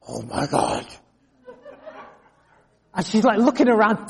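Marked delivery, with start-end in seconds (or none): 0.1-0.9 low, hushed, appalled gasp of a line; a shocked lady under her breath; shocked whisper. Retold or imitated. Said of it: "you could see her face".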